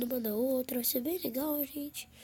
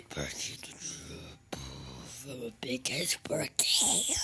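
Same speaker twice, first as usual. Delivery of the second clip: low creepy voice; 13 semitones lower, much slower than usual. Acted. Calling a pig in the game.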